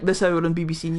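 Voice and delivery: imitating a composed news presenter; mock-serious news intro; dead-serious newsreader. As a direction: drop into a straight newsreader voice. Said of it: "just going dead serious".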